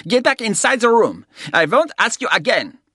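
put-on loud, high German-accented order. A narrator's reading. German guest orders the woman inside, German accent. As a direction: command her in a guttural German accent.

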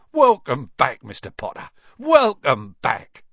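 emotional welcome. A narrator's reading. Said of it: "tears in his eyes".

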